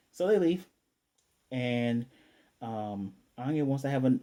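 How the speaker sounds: usual speaking voice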